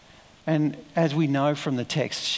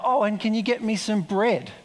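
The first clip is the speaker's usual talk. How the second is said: calling out higher, as an afterthought; 6 semitones higher than usual. Retold or imitated. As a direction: call it back over the shoulder, casually. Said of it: "Elijah sort of calls out".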